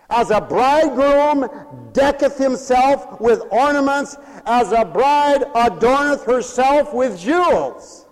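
high, loud scripture proclamation; full-force preaching. Real, not acted. Preacher singing with the prophet of joy, full-force preaching. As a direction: declaim the verse in long, ringing phrases.

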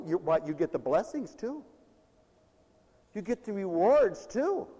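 usual speaking voice

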